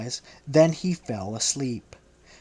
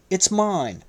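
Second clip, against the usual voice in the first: proud, higher reply; 6 semitones higher than usual. A narrator's reading. Peter proudly answers Jesus, proud. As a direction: say it with pride. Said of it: "Peter proudly replied".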